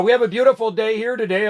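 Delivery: usual speaking voice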